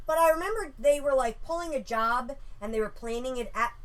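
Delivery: ordinary delivery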